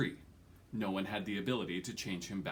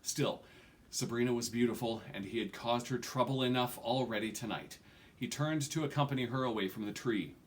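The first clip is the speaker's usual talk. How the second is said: ordinary delivery, nothing marked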